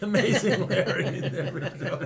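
high, laughing recognition. Real, not acted. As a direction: laugh out the name.

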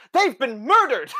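high, loud panicked cry; alarmed shout. Acted. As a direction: blurt it out in shock. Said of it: "he just says".

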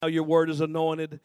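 loud, steady prayer from the pulpit; preaching prayer. Acted. Preacher praying over the congregation at full voice. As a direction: lift it up as a fervent prayer.

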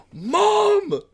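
very high, loud mock cry of Mom; exasperated yell. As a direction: shriek it in disbelief. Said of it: "And I'm like".

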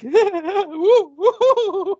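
high, loud falsetto woo-woo; mock whooping. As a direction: whoop it up like a clueless fan. Mimicking excited people.

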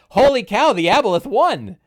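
high, loud burst of astonishment; amazed exclamation. Retold or imitated. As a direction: marvel at an unexpected win. DM reacts to monster's victory.

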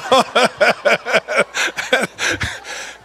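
long high laugh at his own punchline; real laughter; hearty laugh. Real, not acted.

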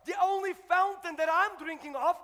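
loud, rising sermon shout; full-force preaching. Acted. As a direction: preach it out, roaring to the church.

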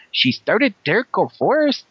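put-on playful Mexican Spanish accent, Chicano slang. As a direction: lean into a Mexican accent like a homie greeting the crew. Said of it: "interesting accent".

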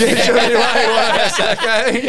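high, loud, slow mock outrage; high, loud, mock-offended insistence; mock indignant. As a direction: ham up the offended Aussie. Mocking the Aussie complainer.

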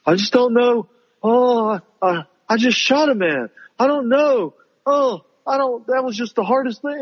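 high, whiny mock of a hand-wringing teen; mock whiny girl. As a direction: whimper it out like an overwrought teen heroine. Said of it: "she has to talk about for ten, fifteen minutes".

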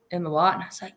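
ordinary delivery

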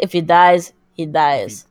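low, deliberate Russian heavy; Russian accent. Acted. Host quoting the Rocky villain, deep Russian accent. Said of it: "And then he goes".

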